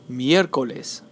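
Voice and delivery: Miércoles stressed on MIÉR; stress third from last. Shown as a demonstration. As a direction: hit the first syllable of Miércoles. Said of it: "The stress is on the third to last syllable".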